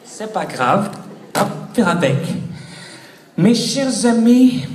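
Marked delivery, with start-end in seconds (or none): 3.4-4.7 slow, louder grand opener; mock presidential address; rally opener. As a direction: open the speech like a statesman. Relaunching speech, louder.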